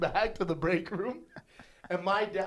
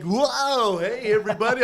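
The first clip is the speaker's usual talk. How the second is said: high, drawn-out mascot greeting; mascot bird; 6 semitones higher, 5 dB louder, slower than usual. Retold or imitated. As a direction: imitate an overcheerful restaurant mascot. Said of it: "he's like".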